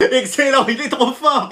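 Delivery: high, amused laughing praise; laughing approval. Acted. Classmate to the class clown, laughing approval. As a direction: laugh while cheering the joker on.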